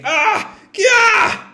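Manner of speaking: high, sharp cries of pain; pained screams. Acted. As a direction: scream as the lightning hits. Crews hit by lightning.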